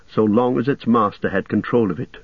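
ordinary delivery

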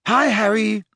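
high-pitched schoolgirl hello; Parvati's greeting. A narrator's reading. Parvati greeting Harry beside the embracing couple, high girlish voice.

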